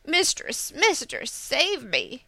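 high-pitched little creature in a panic; frightened, high plea falling toward the end; pleading for rescue. A narrator's reading. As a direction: call out urgently for help. Fleeing tanuki begs for help.

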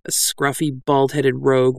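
ordinary delivery, nothing marked

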